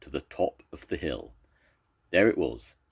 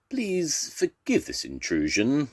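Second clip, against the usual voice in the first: slow, higher-pitched polite warthog; 10 semitones higher than usual. A narrator's reading. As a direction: beg pardon slowly as a storybook warthog. Warthog addressing the beast.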